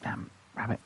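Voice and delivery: muttered whisper of annoyance; whispered curse. Real, not acted. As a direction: curse it under your breath.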